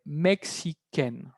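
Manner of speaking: "mexicaine" slowly, ending "ken"; changed feminine ending. Shown as a demonstration. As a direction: break "mexicaine" up for learners, N sounded. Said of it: "hay un cambio de pronunciación".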